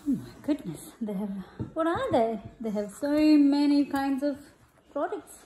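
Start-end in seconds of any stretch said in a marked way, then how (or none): none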